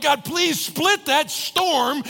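high, drawn-out pleading; hammed-up plea to heaven; mock desperate prayer. Preacher retelling his storm prayer, comically pleading.

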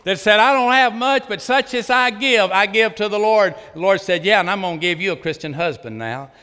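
high, loud, ringing preacher's climax; full-force preaching. Acted. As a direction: preach it out at the top of your voice.

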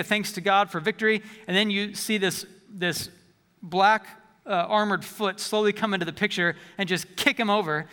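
normal talking voice

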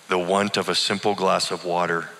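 plain reading voice

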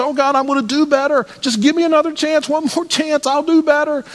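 imitating a frantic, begging prayer; desperate pleading prayer. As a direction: plead like a failing sinner on his knees. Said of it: "and I'd say".

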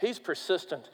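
quiet, intense repetition; hushed emphasis. Acted. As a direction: drop it right down to land the point. Preacher drops to emphasize.

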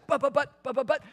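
high mock sputtering protest; protesting coaches. Preacher mimics coaches sputtering back at the umpire, high mock protest.

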